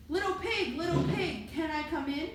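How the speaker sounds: ordinary delivery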